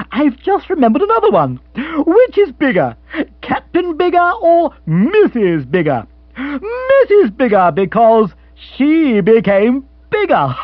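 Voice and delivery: lifted voice, breaking into laughter at the punchline; gleeful silly gentleman. Acted. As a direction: deliver a feeble riddle with huge self-delight. Rory tells his silly riddle.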